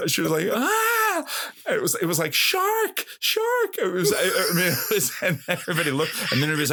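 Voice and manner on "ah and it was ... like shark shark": hammed-up girl's scream of shark; dramatic scream. Girl in front reacting to his kneecap, with a mock shriek. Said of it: "her reaction was so dramatic".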